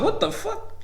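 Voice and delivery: squeaky high laughing disbelief, mock shocked; stunned disbelief. Eighth grader hearing the girl's dad rule him out, shocked and laughing.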